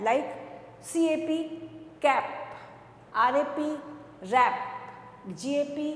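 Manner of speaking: ordinary delivery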